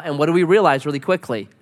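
usual speaking voice

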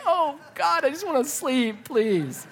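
high, soft tired plea; mock pleading. Dad kicked in bed, softly pleading for sleep. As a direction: beg for sleep, half laughing. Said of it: "I'm like".

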